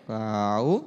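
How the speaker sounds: ordinary delivery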